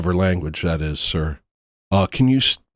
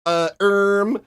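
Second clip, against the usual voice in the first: mock awkward hesitation; 10 semitones higher, much slower than usual.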